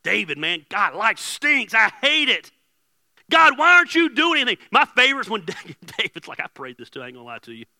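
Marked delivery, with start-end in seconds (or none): 0.0-4.6 loud, high, building complaint; angry venting. Acted. David venting to God about his life, angry and loud. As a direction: rail at heaven, getting louder and higher.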